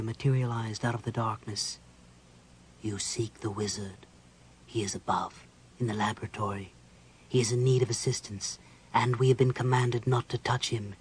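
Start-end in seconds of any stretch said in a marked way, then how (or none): 2.8-10.9 low, quieter, otherworldly and distant; cold specter voice. Acted. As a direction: speak like a detached ghost guardian. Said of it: "said a cold voice".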